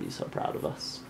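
whispering. Real, not acted. Baby asleep, whispering.

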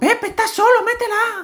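excited urging. Acted. Urging teammate in game.

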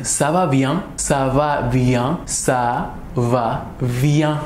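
ça va bien drawn out very slowly; slow, careful ça va bien; slow demo. Shown as a demonstration. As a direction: spell it out slowly for learners. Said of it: "quieren precisar el ça va".

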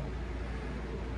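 long unvoiced sigh; heavy nervous sigh. Real, not acted. Bracing to eat frog, a heavy sigh. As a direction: let out a big nervous breath.

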